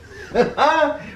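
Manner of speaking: bright, high burst of laughter; amused laugh. Real, not acted. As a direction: laugh at your own joke. Laughing at own surprise.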